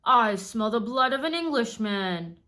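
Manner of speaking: loud, booming giant, slowing at the end; giant's voice. A narrator's reading. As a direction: menace the whole castle. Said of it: "said the giant".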